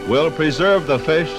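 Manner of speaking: ordinary delivery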